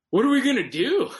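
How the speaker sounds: high, loud panicked question; mock panic, voice jumping way up; panicked cry. Friend stranded on the freeway shoulder, mock panicked yell.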